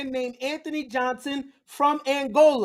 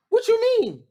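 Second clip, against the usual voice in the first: mock confusion; 10 semitones higher than usual. Mock confused question, high.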